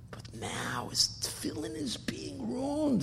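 slow, hushed whisper of dismay; whispered slowly with dread. A narrator's reading. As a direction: draw it out in a dramatic whisper. Dramatic turn in the story.

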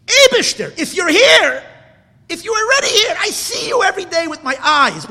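high, loud, crying cry to God; tearful plea. Real, not acted. Preacher crying out to God in his frustration, tearful and loud. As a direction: break into tears, pleading with him.

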